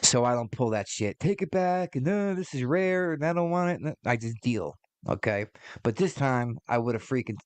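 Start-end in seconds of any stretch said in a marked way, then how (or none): 1.2-3.8 mock fussy customer. Mocking a customer sending food back.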